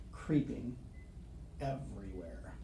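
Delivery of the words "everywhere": plain reading voice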